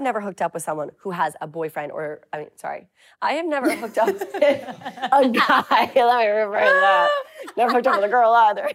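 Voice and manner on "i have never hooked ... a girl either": laughing through words. Real, not acted. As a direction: giggle while correcting herself. Laughing at own slip.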